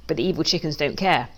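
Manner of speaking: usual speaking voice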